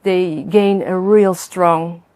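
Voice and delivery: ordinary talk, nothing marked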